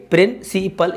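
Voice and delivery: "Principal" in three slow, separate beats, प्रिं-सि-पल; syllable by syllable. Shown as a demonstration. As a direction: break "principal" into three parts with clear pauses. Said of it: "syllable division हो जाएंगे". ("Principal" को तीन हिस्सों में रुक-रुककर बोलें)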